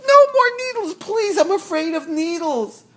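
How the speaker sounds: high, loud hammed-up begging; mock fear. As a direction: plead in playful panic. Pretending to fear the needle.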